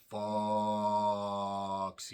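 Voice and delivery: hugely stretched, held on one note; drawn-out name. Pretend. Announcing a wrestler's name for fun, drawn out. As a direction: hold the last word like a long announcer call. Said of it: "That's what I'm breaking that out for".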